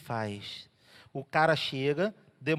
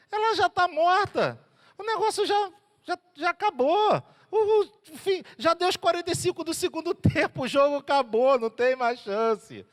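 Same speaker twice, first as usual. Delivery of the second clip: higher, scornful crowd voice; high mocking know-it-all tone; mocking dismissal; 10 semitones higher, 3 dB louder than usual. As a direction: sneer that it is all over.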